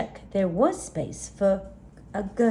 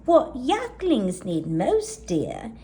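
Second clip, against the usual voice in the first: granny voice; 6 semitones higher, 4 dB louder than usual. Acted. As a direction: coo it like a gentle old granny. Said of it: "Gran nodded".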